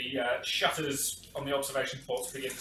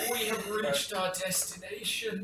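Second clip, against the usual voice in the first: slow, higher, strained report; strained navigator; 5 semitones higher, slower than usual. Acted. Navigator announcing arrival to the captain, slow and strained. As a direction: labour through it as the straining navigator. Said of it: "strained voice coming through".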